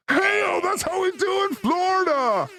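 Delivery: mock Florida hype. Acting a Florida host.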